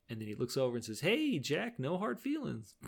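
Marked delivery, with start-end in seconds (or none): 1.0-2.7 high, lilting goodbye; playfully high-pitched no-offense send-off; mock-friendly farewell. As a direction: toss it off in a raised, chummy voice. Acted breezy farewell from golem head.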